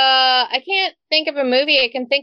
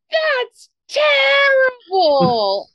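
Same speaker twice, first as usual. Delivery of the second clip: high, slow, mock-tearful little girl; child crying; 12 semitones higher, much slower than usual. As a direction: wail it like a heartbroken kid. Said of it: "she goes".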